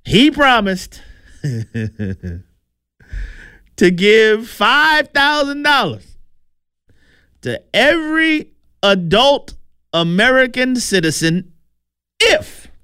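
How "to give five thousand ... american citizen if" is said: much higher, slow "give five thousand dollars"; disbelieving, drawn-out emphasis; incredulous. Real, not acted. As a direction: stretch it out in disbelief. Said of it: "incredulous reporting of promise".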